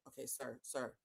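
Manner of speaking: barely audible, controlled; restrained. Retold or imitated. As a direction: rein yourself in to near silence. Story, restraining herself with painter.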